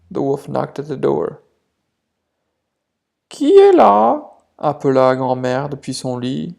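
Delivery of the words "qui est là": put-on high, loud old grandma voice; grandma calling. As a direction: call out from bed as grandma. Grandma calls from bed. (grand-mère appelle depuis son lit, voix aiguë)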